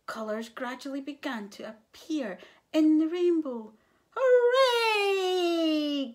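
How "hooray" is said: high, loud, long-drawn cheer. A narrator's reading. Animals see the rainbow's colours return, cheering. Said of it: "cheered the animals".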